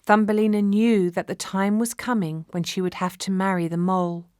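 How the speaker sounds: ordinary delivery, nothing marked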